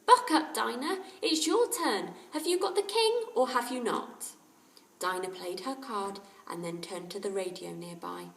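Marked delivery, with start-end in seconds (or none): 0.1-4.3 higher, louder, urging on; impatient boy. A narrator's reading. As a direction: hurry her along impatiently. Boy hurrying sister at cards.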